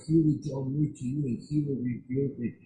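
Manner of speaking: usual speaking voice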